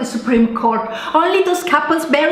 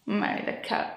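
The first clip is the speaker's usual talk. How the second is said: muttered aside; 7 semitones lower, 13 dB quieter than usual. Acted. Mutters insult after fight.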